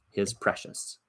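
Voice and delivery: breathy whispered hiss. Acted. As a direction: whisper it like Gollum. Narrator whispers Gollum's word.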